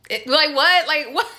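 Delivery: asking what in disbelief; baffled by the rude customer, incredulous. Real, not acted.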